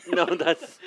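ordinary talk, nothing marked

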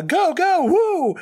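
high, loud whooping cheer; excited cheering. Acted. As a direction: cheer them on, leaping up high. Cheering on characters while reacting.

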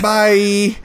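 high sing-out goodbye; nervous sign-off. Acted. Boy hangs up on mom, high and nervous. Boy hurriedly ends the call.

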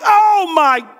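high, hammed-up panicked shout; acted frightened outburst; freaking out. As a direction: cry out in mock alarm, stretching the words. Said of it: "because they're freaking out. They're like".

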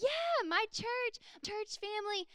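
bursting with pride about his church; imitating an excited John boasting. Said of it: "So he was pretty excited".

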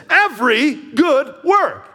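loud, high, slow, each word stressed; emphasize every good work. Shown as a demonstration. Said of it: "oh, let's emphasize this".

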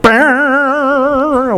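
high, wavering motor whirr; imitated mechanical groan. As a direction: do the jammed garage-door opener noise. Imitates broken garage door noise.

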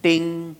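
high, sustained "ding"; imitated bell ding. Preacher marks the first target falling, imitated bell ding. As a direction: sound the bell on one ringing note.

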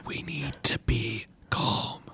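slow hushed whisper; slow, soothing whisper. Acted. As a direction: whisper to calm a jumpy ally. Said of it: "not actually whisper".

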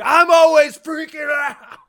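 high, slow, loud scream; bug-eyed spittle-flying yell; maniac old man shout. Pretend. Old man shares his secret, screaming.

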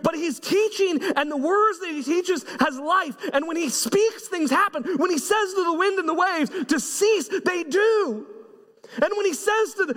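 high, fervent preaching build; preaching at full force. Acted. Pastor preaching to his congregation, high and fervent. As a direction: lift the room with rising fervour.

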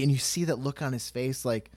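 ordinary talk, nothing marked

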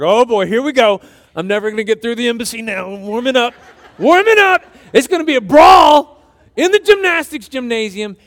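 all fired up and laughing; hyped-up. Retold or imitated. Narrator to congregation, reliving his excitement before a fight, laughing loud and high. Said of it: "I'm like".

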